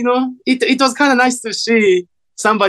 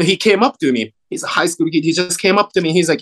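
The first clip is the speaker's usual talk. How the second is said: normal talking voice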